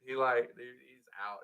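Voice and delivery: normal talking voice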